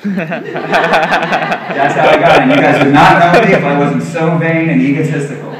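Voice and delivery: laughing through the words. Real, not acted. Speaker laughs at his own vanity while confessing it, laughing. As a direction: chuckle while owning up to vanity.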